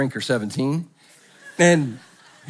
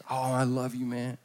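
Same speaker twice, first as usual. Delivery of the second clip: much softer, slurred love you man; drunk declaration; 3 semitones lower, 9 dB quieter than usual. Retold or imitated. Drunk friend declares love to the listener, much softer. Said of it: "tell us how much they love us".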